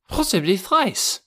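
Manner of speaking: high-pitched character voice for an insistent doctor; fussy doctor. A narrator's reading. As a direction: interject in a high, piqued doctor's voice.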